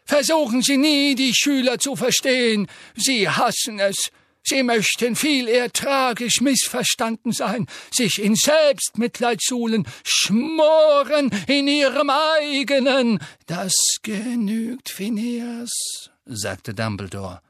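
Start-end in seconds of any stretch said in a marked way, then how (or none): 0.1-13.2 high, loud, sneering portrait voice; a sly old headmaster mocking Dumbledore. A narrator's reading. Phineas sneers slyly at Dumbledore, high and loud. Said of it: "sagte Phineas Nigellus hinterlistig". 13.4-16.1 high, slow, lightly voiced Dumbledore; a gentle stop, no raised voice; calm old headmaster. A narrator's reading. Dumbledore rebukes portrait.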